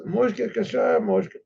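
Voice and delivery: a genial Russian wagoner urging him on; jovial driver. Acted. Gentile driver offers the vodka.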